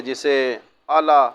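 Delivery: ordinary talk, nothing marked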